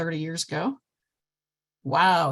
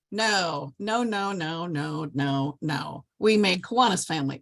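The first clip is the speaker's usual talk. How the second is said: ordinary delivery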